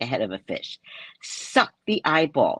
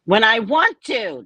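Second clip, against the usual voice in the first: higher, louder reply as God; a hint of a playful God voice; God's voice; 8 semitones higher, 4 dB louder than usual. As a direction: answer as a cheeky God. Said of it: "That's God".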